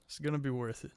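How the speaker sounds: soft murmured promise; quiet. Real, not acted. Repeats promise much softer.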